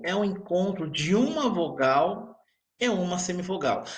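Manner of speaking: ordinary delivery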